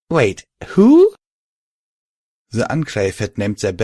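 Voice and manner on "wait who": high, loud double take; wait, who, thrown high; disbelieving. Acted. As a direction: blurt it in disbelief. Disbelief at the name.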